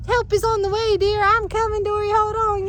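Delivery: high, loud call to reassure; reassuring call. Retold or imitated. Owner calling to her drowning dog, high and loud. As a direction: shout comfort while pushing through the woods.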